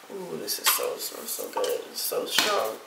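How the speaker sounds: normal talking voice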